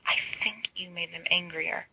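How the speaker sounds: soft unvoiced whisper. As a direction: hush it so the pumpkins won't hear. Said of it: "James whispers".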